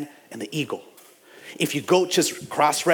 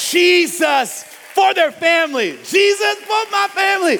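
higher and louder, building to a shout; preaching shout; 13 semitones higher, 5 dB louder than usual. Real, not acted. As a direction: cry out Jesus for my family.

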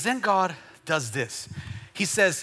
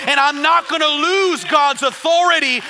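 raised, driving declaration building in intensity; full-force preaching; 8 semitones higher, 6 dB louder than usual. Real, not acted. Preacher declaring God's word to the church, full-force preaching. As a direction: preach it with forceful punched phrases.

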